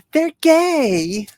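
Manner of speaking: high, sneering mock voice. Mocking a simplistic explanation.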